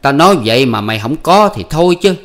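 high, loud bickering voices; husband and wife arguing at night, high and loud; heated quarrel. Acted. Voiced marital quarrel continues.

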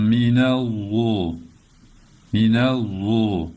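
"minel zu" with a heavy, thick lisped z, slow. Shown as a demonstration. Teacher demonstrates the heavy z in "minel zu", slow. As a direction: darken the z in "minel zu". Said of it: "Kalın olacak".

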